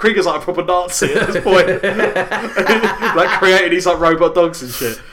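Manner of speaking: laughing through the words; giggling while talking. Real, not acted. Laughing while describing Krieger.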